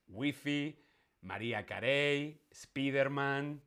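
"Wifi, [name], Spiderman" as WEE-fee, ma-REE-a ka-RAY, SPEE-der-man; Spanish pronunciation. Shown as a demonstration. Teacher listing examples of hispanicised English words, Spanish pronunciation. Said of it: "siempre españolizamos todas las palabras inglesas".

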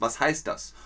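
ordinary delivery

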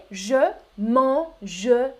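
slow "mangerai", all three syllables spelled out; "mangerai" slow, syllable by syllable, man-ge-rai; southern French "mangerai", every syllable; southern pronunciation. Shown as a demonstration. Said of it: "on prononce toutes les syllabes".

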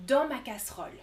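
normal talking voice

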